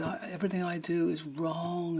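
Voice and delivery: high, whiny imitation of despair; mock near-tearful self-pity; nearly crying. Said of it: "like, nearly crying".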